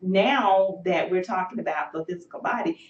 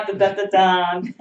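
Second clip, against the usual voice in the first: dun dun dun dun, slow and louder like a fanfare; drumroll; 2 semitones higher, 9 dB louder than usual. Acted. Host building up the announcement with a mock drumroll.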